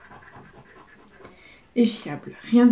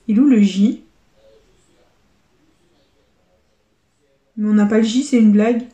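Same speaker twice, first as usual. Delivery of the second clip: loud, stunned outcry at the missing letter; real dismay, voice jumping up in volume; disgusted disbelief; 5 dB louder, faster than usual. Real, not acted. Scratching a losing letter to viewers, loud dismay. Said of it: "je suis dégoûtée".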